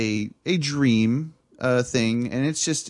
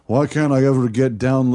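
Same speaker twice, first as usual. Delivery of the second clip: voicing Kim Jong-un; 5 dB louder than usual. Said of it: "and he's like".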